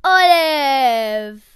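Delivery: a loud, drawn-out "Olive"; loud name call. Acted. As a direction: belt out "Olive" like a jingle. Shouts her name as the show's jingle.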